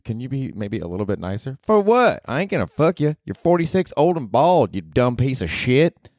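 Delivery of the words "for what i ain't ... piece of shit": Ruth from Ozark. Acted. Podcaster voicing Ruth, cursing at him, brash and high. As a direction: snap it like a foul-mouthed hillbilly girl.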